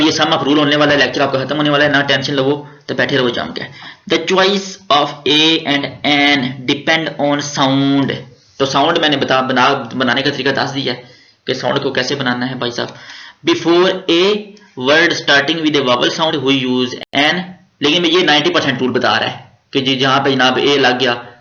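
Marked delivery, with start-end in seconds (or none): 4.1-8.1 the rule read out very slowly; slow dictation. Shown as a demonstration. Teacher dictates the grammar rule to students, slowly. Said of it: "teacher reads rule aloud". 13.5-17.4 the rule read out very slowly; slow dictation. Shown as a demonstration. Teacher dictates the grammar rule to students, slowly. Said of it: "teacher reads rule aloud".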